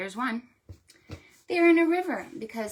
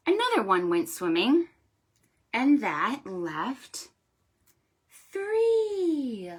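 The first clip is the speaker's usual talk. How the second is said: storytime rhyme; 3 semitones higher than usual. Shown as a demonstration. Librarian reciting a counting rhyme to children. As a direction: read it like a picture-book rhyme.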